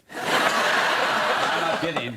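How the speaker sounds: laughing. Real, not acted. Laughing confession.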